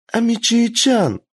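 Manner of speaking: put-on high, slow girlish question; little girl voice. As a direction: ask timidly like a child. Kitsune girl asks.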